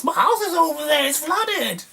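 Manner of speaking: high mock wail of a distraught woman; a high, hammed-up wail of a woman whose house is flooded; imitating screaming woman. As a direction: wail it like she's lost everything. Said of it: "screaming about her house".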